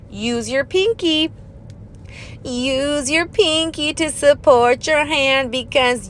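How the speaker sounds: slow and deliberate, leaning on pinky; firm slow instruction; slow coaching emphasis. Shown as a demonstration. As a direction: drill it in like a coach. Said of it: "I'm telling you right now".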